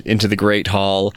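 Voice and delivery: usual speaking voice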